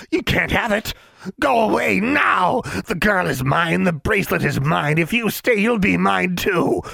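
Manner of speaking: angry swamp creature. Acted. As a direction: drive them off like a monster.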